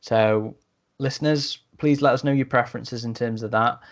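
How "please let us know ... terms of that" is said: ordinary talk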